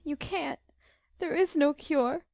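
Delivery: high, tearful despair; voice giving way. A narrator's reading. Beth telling Jo of her pain, voice giving way. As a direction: let the voice break into crying. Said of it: "There Beth's voice gave way".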